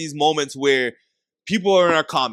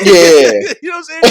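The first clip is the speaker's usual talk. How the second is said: usual speaking voice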